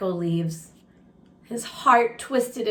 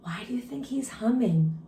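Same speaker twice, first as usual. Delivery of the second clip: whisper; 4 dB quieter, faster than usual. Real, not acted. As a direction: lean in and breathe the question, no voice. Teacher whispers question to listeners.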